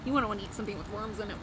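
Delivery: ordinary delivery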